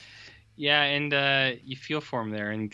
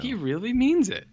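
amazed; 11 semitones higher, 4 dB louder than usual. Retold or imitated. Kids realise he means it.

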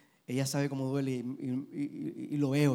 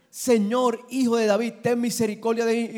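loud, higher plea of the blind man; preached cry for mercy; 8 semitones higher, 9 dB louder than usual. Acted. Blind Bartimeus crying out to Jesus, loud pleading cry. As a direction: cry out for mercy across a crowd.

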